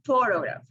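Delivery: PHO-tograph, with the opening syllable strongest; first-syllable stress. Shown as a demonstration. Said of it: "word stress is in the first syllable".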